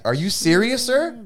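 retold protest climbing high at the end; indignant disbelief. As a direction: imitate an incredulous challenge, pitch rising sharply. Retold argument with casino staff.

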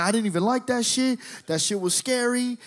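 high retold protest; panicked confession. Panicked son telling Dad it was scary, high pleading. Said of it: "sees how panicked I am".

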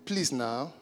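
low, soft mock begging; mock pleading. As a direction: beg the cashier in a small low voice. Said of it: "that's Nigerian for you".

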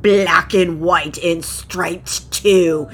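slow, solemn crocodile reciting a riddle. Acted. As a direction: intone the riddle slowly like a grand reptile king.

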